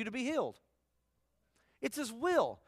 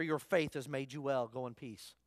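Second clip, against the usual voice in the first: tender blessing; 8 semitones lower, 6 dB quieter than usual. Acted. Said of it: "he said to her".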